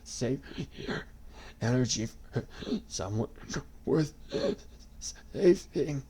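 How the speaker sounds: breathless broken dying words; gasping. A narrator's reading. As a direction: gasp each word out. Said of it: "he gasped".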